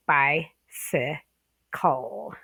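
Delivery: "bicycle" drawn out slowly, each syllable clear; slow careful model. Shown as a demonstration. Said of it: "Remember the C L E?".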